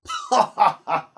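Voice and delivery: loud mocking laugh; a big scornful laugh; knight's laugh. A narrator's reading. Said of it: "for one laugh".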